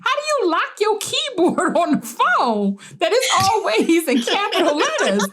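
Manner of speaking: incredulous question with laughter in it; amused, puzzled laughing question; laughing disbelief. Retold or imitated. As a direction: laugh while asking, half confused. Retold confused question to aunt.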